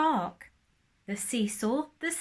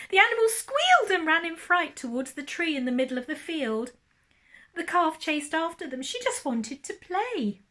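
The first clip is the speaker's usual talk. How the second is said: excited story narration; 7 semitones higher, 5 dB louder than usual. Acted. Excited read-aloud narration of chase.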